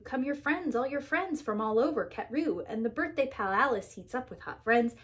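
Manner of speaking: ordinary delivery, nothing marked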